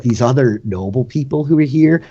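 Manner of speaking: normal talking voice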